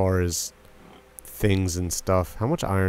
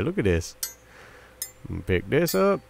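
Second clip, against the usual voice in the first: ordinary talk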